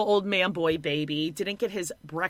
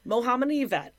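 ordinary talk, nothing marked

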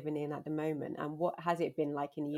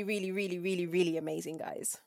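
usual speaking voice

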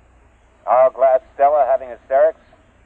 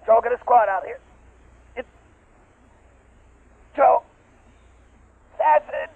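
panicked screaming; 11 semitones higher, 4 dB louder than usual. Acted. Trapped, being digested.

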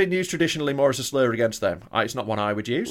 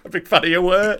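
mock fan voice; 7 semitones higher, 5 dB louder than usual. Mocking the fan voice.

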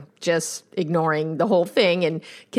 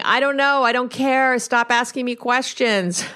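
imitating a husband's silent frustration; exasperated mock outburst; mild mock yell; 5 semitones higher than usual. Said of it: "He was screaming".

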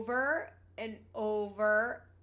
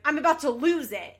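loud, frazzled mock outburst to David; hammed-up exasperated yell, like she's at her limit; imitating Janelle losing it; 5 semitones higher, 12 dB louder than usual. Janelle on speakerphone to David, mock furious outburst. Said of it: "I'm so bad at doing Janelle".

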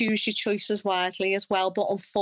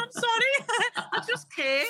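slow, much higher apology through laughter; laughing mock apology; 14 semitones higher, slower than usual. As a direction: act sheepishly sorry while giggling. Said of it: "And I'm going".

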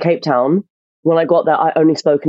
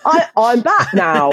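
slow, higher, badly faked English accent; botched English accent; 5 semitones higher, much slower than usual. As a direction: overdo a failed English accent, slowly. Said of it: "trying to do an English accent".

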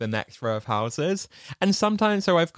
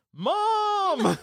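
high, drawn-out mock cry for mom; long, high-pitched playful holler; imitated boy calling. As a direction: call out like a startled kid, stretched high. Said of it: "one of them goes".

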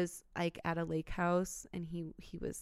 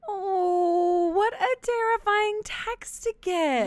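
high, shocked and horrified; shocked gasp; 11 semitones higher, 7 dB louder, slower than usual. Real, not acted. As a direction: gasp it out in horror. Reacting to scary text.

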